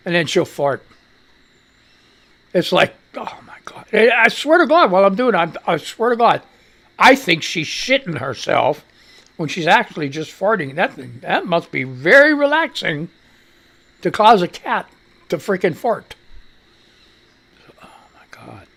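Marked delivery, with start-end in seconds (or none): 3.2-3.9 breathy whisper of disbelief; hushed appalled whisper; whispered disgust. Real, not acted. Disgust at cat fart. 17.8-18.7 low, breathless whisper; barely voiced groan of despair; exhausted whisper. Real, not acted. Overwhelmed by cat.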